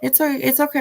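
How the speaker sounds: high, lightly voiced imitation of a falsely calm father; sing-song fake reassurance, imitated; mock soothing father. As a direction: mimic him cooing that he's not mad. Imitating father reassuring his son.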